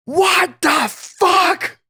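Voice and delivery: high, strained angry-dad scream; imitated screaming. Hot-headed dad bursting outside at the kids, screaming. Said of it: "just screaming all the time".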